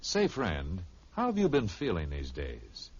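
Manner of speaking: usual speaking voice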